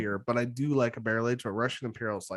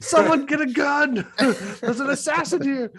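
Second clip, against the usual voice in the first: high, loud, drawn-out mock panic; alarmed dog voice; 11 semitones higher, 5 dB louder, slower than usual. Host retells a joke about a barking dog, mock-alarmed. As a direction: cry out like a frantic dog, laughing through it. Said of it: "it says".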